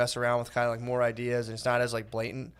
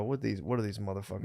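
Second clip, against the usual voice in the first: low, much softer aside; muttered quote; 5 semitones lower, 11 dB quieter than usual. Retold or imitated. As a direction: grumble it under your breath. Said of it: "but I go".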